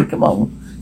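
breathy whispered coaxing. Acted. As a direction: whisper it gently to calm her. Soothing a frightened child.

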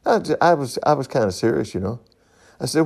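usual speaking voice

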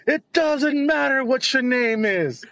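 high, drawn-out mock wrestler catchphrase; imitated toddler catchphrase. Toddler cutting off a stranger in the store, high drawn-out taunt. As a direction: stretch it out high, a toddler playing wrestling star. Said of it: "and you say".

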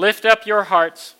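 loud call to lift hearts; liturgical call. Acted. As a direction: proclaim it to the congregation.

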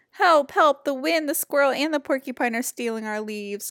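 loud high shouts of help, fading at the end; crying out for help. Acted. Fletcher shouting for help against wind, squirrel and porcupine, loud. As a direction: yell in alarm, loud and high. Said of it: "cried Fletcher".

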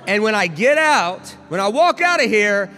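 high, loud impassioned proclamation; fervent, soaring declaration; triumphant Jesus. Retold or imitated. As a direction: build to a full-force preacher's shout. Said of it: "Jesus walked into hell and said".

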